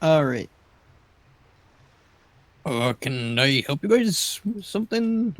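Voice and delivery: character voice. Acted. Bud asks the watchers.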